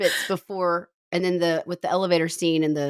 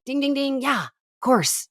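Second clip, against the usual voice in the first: sarcastic; 7 semitones higher, slower than usual. Shown as a demonstration. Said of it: "I'm being sarcastic".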